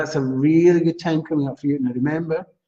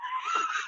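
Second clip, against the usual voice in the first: burst of laughter; 11 dB quieter than usual. Real, not acted.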